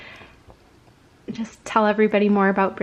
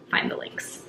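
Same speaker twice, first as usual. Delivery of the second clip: whispered; 7 dB quieter than usual. Real, not acted. Trailing aside to viewers.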